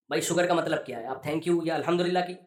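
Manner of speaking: ordinary talk, nothing marked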